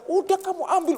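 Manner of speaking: high urgent call to take the rope. Retold or imitated. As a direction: yell at him to grab it. Neighbour urges him to grab.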